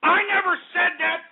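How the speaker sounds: high, indignant denial; angry denial. Acted. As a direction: protest in a high, outraged pitch. Host denies accusations angrily.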